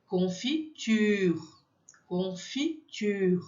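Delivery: confiture very slowly, u with rounded lips; French confiture. Shown as a demonstration. Teacher drilling French u, very slow. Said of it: "boca de U, som de I".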